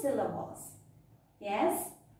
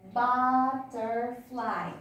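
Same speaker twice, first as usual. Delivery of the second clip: BUTTERFLY very slow, in three separate beats; syllable by syllable; much slower than usual. Shown as a demonstration. Teacher showing children three sounds, butterfly broken into slow syllables. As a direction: stretch out each syllable of "butterfly". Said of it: "So there are three sounds".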